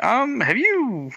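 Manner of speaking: high, slow, hesitant retort; tentative objection. Retold or imitated. New hire interrupts the trainers' spiel, high tentative retelling. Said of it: "And I'm like".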